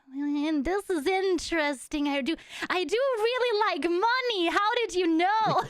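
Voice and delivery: high, rising, hammed-up interest in money; retold eager delight. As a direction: play up your delight at the cash, pitch climbing. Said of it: "and I was like".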